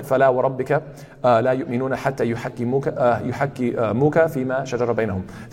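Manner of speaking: تلاوة سريعة; Quran recitation. Shown as a demonstration. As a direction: rattle off the verse from memory.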